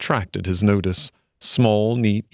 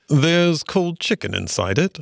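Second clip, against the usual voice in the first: plain reading voice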